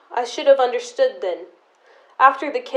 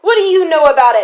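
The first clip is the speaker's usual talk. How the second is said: loud, fast and high; snapping at sister in the heat of a quarrel; furious demand; 6 semitones higher, 9 dB louder, faster than usual. Acted. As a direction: fire the question at her. Angry quarrel climax.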